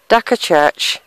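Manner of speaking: ordinary delivery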